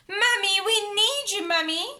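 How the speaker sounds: high, loud call upstairs; shouting. A narrator's reading. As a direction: shout for mummy. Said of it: "they heard Peppa shout".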